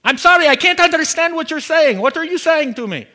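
high, baffled imitation of an Indian phone minister; Jerry, Indian accent. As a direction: put on an Indian accent, loudly apologetic and confused. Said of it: "precious little Indian guy named Jerry".

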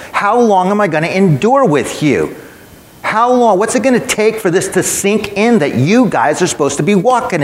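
high, urgent rebuke of the disciples; Jesus rebuking. Shown as a demonstration. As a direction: plead and scold like an exasperated teacher. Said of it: "Let me read it the way Jesus would say it now".

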